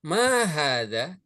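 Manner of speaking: "Ma hadza" slow, long "Ma", rising at the end; question intonation. Shown as a demonstration. Teacher shows students how questions differ, questioning "Ma hadza". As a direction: ask "Ma hadza" with stretched vowels and a clear lift. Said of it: "perbedaan dari segi intonasi".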